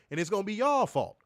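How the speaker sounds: ordinary talk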